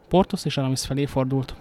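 ordinary delivery

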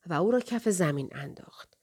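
usual speaking voice

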